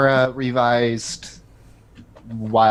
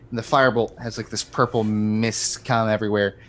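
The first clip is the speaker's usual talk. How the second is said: ordinary delivery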